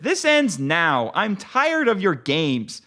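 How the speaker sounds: ordinary delivery, nothing marked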